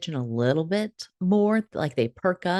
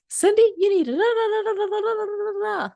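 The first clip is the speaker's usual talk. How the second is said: grandma nagging. Retold or imitated. Grandma scolding little Cindy, high nagging voice. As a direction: rattle it off like a fed-up mum. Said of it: "she goes".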